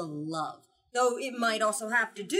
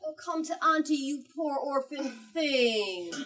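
fake sweet aunt; 5 semitones higher, 4 dB louder than usual. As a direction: lure the orphan in with false sweetness.

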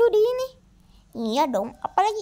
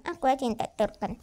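normal talking voice

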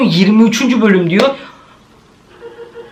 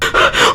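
quick gasp with a whimper; startled intake of breath; sharp scared gasp; frightened gasp. Real, not acted. Something passed in the room.

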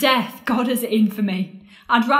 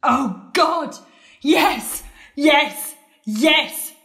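mock ecstatic yes; 4 semitones higher, much slower than usual. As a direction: fake the ecstasy with each yes. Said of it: "I've made an art of saying that".

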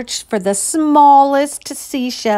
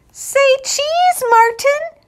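high, sing-song photographer's call; playful girl voice; slower than usual. Acted. Ruthie playfully posing the clouds for a photo. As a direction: coax the clouds to smile.

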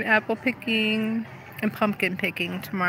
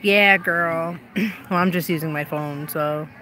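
ordinary talk, nothing marked

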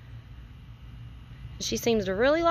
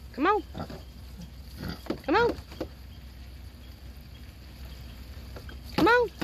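high, rising call to a pet; coaxing call; 9 semitones higher, 5 dB quieter than usual. Real, not acted. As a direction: coax the animal over with a high lilting call. Calling a pet pig over.

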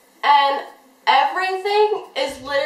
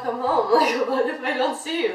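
laughing. Retold or imitated. Joking retold suggestion to boyfriend.

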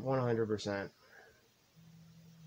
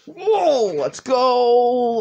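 loud, high excited whoa; hype yell; 10 semitones higher, 9 dB louder, much slower than usual. Real, not acted. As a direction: scream with victory joy. Hype after game success.

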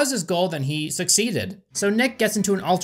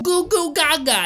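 put-on high babbling baby voice; 11 semitones higher than usual. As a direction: babble like an infant. Baby playing with toys.

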